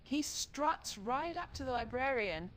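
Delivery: ordinary delivery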